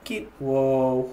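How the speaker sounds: ordinary delivery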